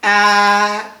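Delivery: sustained flat first-tone "ah", loud and even. Shown as a demonstration. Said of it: "it doesn't change".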